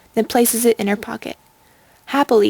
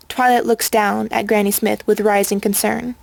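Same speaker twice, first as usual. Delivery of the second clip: ordinary delivery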